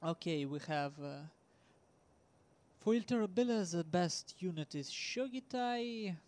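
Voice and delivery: ordinary delivery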